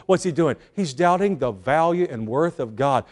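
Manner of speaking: ordinary talk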